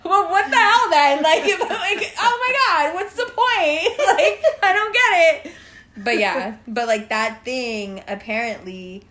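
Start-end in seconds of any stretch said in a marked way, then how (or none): 0.0-5.5 high, loud laughing disbelief; laughing disgust. Retold or imitated. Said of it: "I was like".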